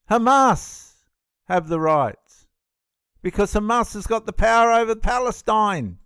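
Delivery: indignant retort. Real, not acted. Indignant answer to the played clip.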